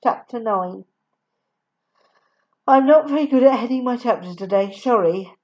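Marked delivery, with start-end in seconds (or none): none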